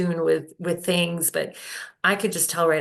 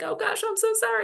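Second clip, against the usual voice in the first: flustered apology; faster than usual. Retold or imitated. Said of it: "at first I was like".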